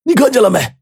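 high-pitched, strained, furious character voice; acted outrage from an injured man; angry demand. A narrator's reading. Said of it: "马王爷就怒道".

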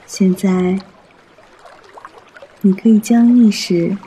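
usual speaking voice

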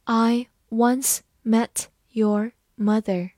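each word separated by a pause; deliberate slow teaching demo; slow reading. Shown as a demonstration. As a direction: read it slowly, word by word. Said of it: "好，慢慢来读".

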